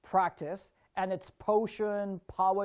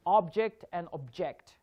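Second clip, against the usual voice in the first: OB-ject, then ob-JECT; "object" stressed both ways in turn; contrasting syllable stress. Shown as a demonstration. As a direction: stress first "object" early, second "object" late. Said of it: "Syllable stress".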